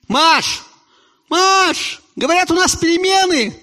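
loud high call to kitchen. Acted. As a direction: shout toward the kitchen. Calling wife in kitchen.

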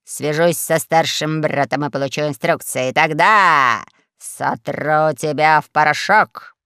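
threat that slows and builds at the end; threaten, drawing out the final words; grim, slowing menace; menacing. A narrator's reading. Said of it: "сказал адмирал грозно".

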